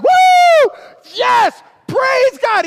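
very high, loud whoops of praise; ecstatic church shout. Retold or imitated. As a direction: whoop like a fan at a revival. Jimmy hooping after song.

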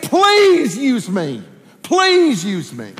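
slow, stretched, fervent plea; earnest begging, high then easing; pleading Isaiah. Retold or imitated. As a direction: draw out PLEASE as you beg. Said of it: "Isaiah said".

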